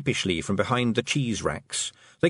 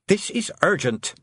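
put-on girl snapping at the toad, higher and sharp; snapped; 9 semitones higher than usual. A narrator's reading. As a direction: cut him off hard. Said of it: "snapped Tiffany".

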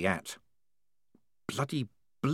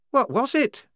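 put-on high, loud worried girl's voice; anxious young woman; 4 dB louder than usual. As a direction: fret in a high voice. Shufti voiced.

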